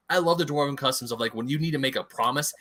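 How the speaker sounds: ordinary delivery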